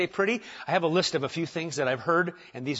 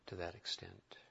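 ordinary talk

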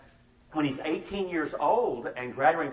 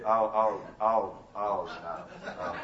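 sinking dread; 6 semitones lower, much slower than usual. Retold or imitated. Kids realize they are caught.

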